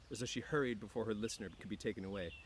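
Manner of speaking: plain reading voice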